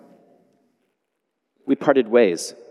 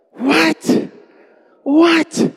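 much higher, loud disbelieving What; astonished What; 4 dB louder than usual. Real, not acted. Preacher to congregation about the apology, astonished high What. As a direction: gasp it out in wonder.

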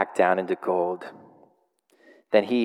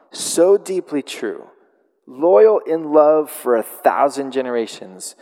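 solemn proclamation; 4 semitones higher, 4 dB louder, slower than usual. Acted. Reading God's self-proclamation aloud.

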